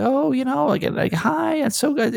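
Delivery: high, mock gushing greeting; gushing fans. People greeting him, high mock gushing voice. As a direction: imitate people fawning over you, pitched high. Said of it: "when people are like".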